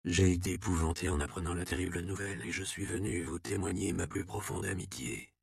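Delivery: very soft bedside voice; hushed sympathy. A narrator's reading. Count assuring the dying abbot of his friendship, hushed. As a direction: speak softly, close to a sick friend.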